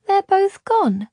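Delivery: put-on a small girl barely grasping the news; stunned little girl. A narrator's reading. Girl to Luca on hearing the man is lost, stunned. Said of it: "she asked".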